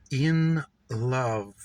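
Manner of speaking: slow, drawn-out In love, falling to rest; savoured echo. Acted. Narrator musing on her own love, slow savouring echo. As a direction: linger on In love as if tasting it.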